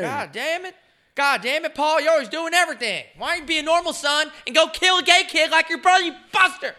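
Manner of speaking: very high, loud hammed-up rant; mock angry dad. The father berates his son, very high and loud. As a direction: berate him like a cartoon dad.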